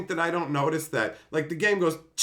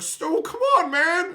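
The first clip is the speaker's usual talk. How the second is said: much higher, louder exasperated whine; whiny buddy; 6 dB louder, slower than usual. Retold or imitated. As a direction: plead like a fed-up friend losing every drop. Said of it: "And he was like".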